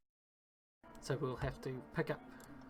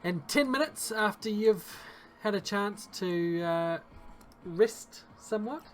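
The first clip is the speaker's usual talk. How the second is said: storytelling game master voice; game master narration; 7 dB louder than usual. Acted. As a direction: set the scene like a dungeon master. Game master describes the scene.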